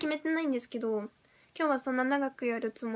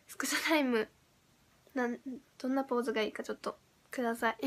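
usual speaking voice

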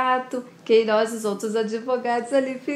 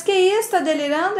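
high, loud burst of mild mock outrage; indignant disbelief; 8 semitones higher, 4 dB louder than usual. Ismael denies Regina's wedding plans, acted indignant disbelief. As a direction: react as if hearing nonsense. Acted protest at marriage announcement.

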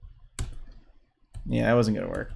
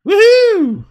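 high, loud woohoo; excited whoop; 8 dB louder than usual. Real, not acted. Player cheering a move in a match, excited whoop. Calling out during live gameplay.

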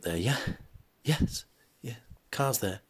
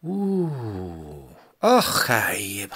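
gruff Scottish lord; 4 semitones lower, much slower than usual. Acted. Scottish man conceding.

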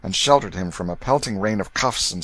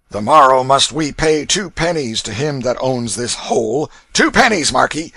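loud, raised ruffian's scolding; angry demand; 5 semitones higher, 7 dB louder than usual. A narrator's reading. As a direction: demand the money like an angry bully. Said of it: "He turned angrily upon the prince".

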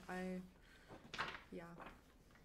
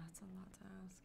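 whisper; 11 dB quieter, much faster than usual. Real, not acted. Hushed aside.

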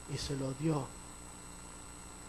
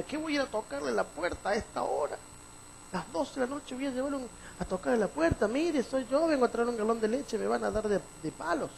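lightly voiced, higher reluctant man; a man grumbling to himself, a touch higher; doubting man; 7 semitones higher than usual. Man arguing with himself about knocking, mildly higher.

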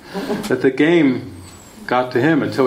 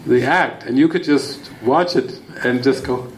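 ordinary talk, nothing marked

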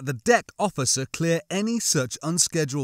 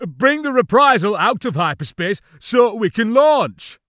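loud, raised, forceful order; biting command; 7 semitones higher, 8 dB louder than usual. A narrator's reading. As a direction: order it like an impersonated ISB thug. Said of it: "putting some bite into his voice".

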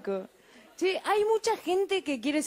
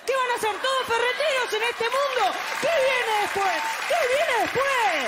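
mock angry rant; 9 semitones higher than usual. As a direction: tear into Ramón in mock fury. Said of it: "Ay, se enojaba".

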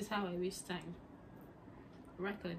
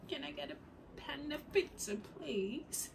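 British accent try; 6 semitones higher, 6 dB quieter than usual. Shown as a demonstration. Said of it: "I'm working on my British accent".

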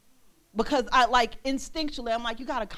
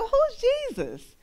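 high, drawn-out curious cry; excited newcomer; 13 semitones higher, 4 dB louder, slower than usual. Retold or imitated. Newcomer joining the cheer for Jesus, excited high cry. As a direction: squeal the question, eager to know.

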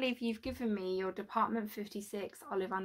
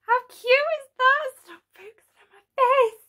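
excited squeal; 14 semitones higher, 10 dB louder than usual. Real, not acted. Gushing over a cute figure.

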